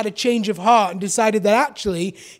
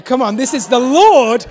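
rousing the congregation; 3 semitones higher, 5 dB louder than usual. Real, not acted. Pastor reviving the flagging congregation, fired up. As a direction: lift the room back up. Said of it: "we're losing enthusiasm here".

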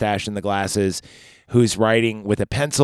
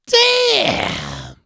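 much higher squeaky mock "Damn"; mock amazed "Damn". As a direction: imitate a bystander's stunned "Damn".

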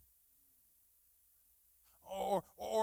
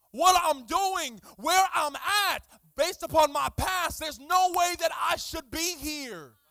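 loud, climbing preacher cadence; full-force preaching; 8 semitones higher, 14 dB louder than usual. Acted. Pastor urging the congregation, loud preaching. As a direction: preach it to the rafters.

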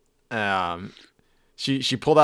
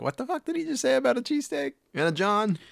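baffled; 11 semitones higher, 4 dB quieter than usual. Retold or imitated. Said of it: "you're like".

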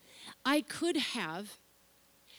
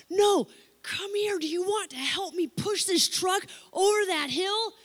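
put-on calling the boys over; 8 semitones higher, 5 dB louder than usual. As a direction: wave them over across the noise.

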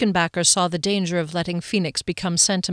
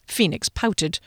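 ordinary delivery, nothing marked

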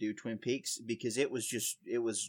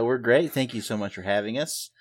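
normal talking voice

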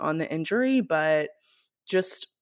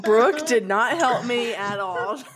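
laughing; 5 semitones higher, 4 dB louder, slower than usual. Real, not acted. Laughing complaint about friend.

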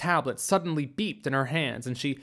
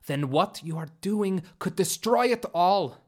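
urgent outburst, pitched up; exclaimed warning; 4 semitones higher than usual. A narrator's reading. Bellry warning captors they could destroy it all, exclaiming. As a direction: burst out with an alarmed warning. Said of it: "Bellry exclaimed".

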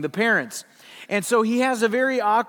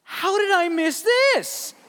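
much higher, incredulous question; stunned disbelief; 12 semitones higher than usual. Retold or imitated. A man realizing a childhood song's meaning, incredulous and high. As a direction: sound floored at missing it.

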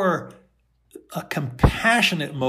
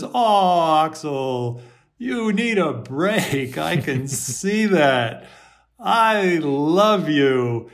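compassionate voice; 4 dB louder, slower than usual. Shown as a demonstration. Compassionate inner voice comforting Axel, warm and slow. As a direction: soothe Axel like a dear friend. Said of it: "The compassionate voice says".

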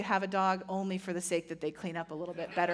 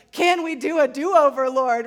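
louder, mock fed-up groan to God; exasperated plea; 3 semitones higher, 10 dB louder than usual. Pastor voicing Jesus fed up with the disciples, loud mock exasperation. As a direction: throw up your hands, half-joking.

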